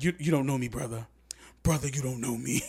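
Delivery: a hint of a preacher scolding.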